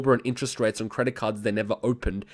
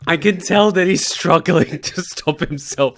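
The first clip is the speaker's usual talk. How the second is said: laughing; 2 semitones higher, 4 dB louder than usual. Real, not acted. Still laughing.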